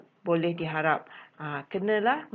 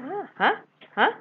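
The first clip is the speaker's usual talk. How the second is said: confused customer; 9 semitones higher, 5 dB louder, faster than usual. Retold or imitated. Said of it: "Kena tanya selalu".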